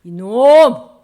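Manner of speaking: loud, booming rebuke; acted shout at a wrongdoer; scolding shout. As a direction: scold at the top of your voice as God. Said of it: "뭐라고 혼냈게?".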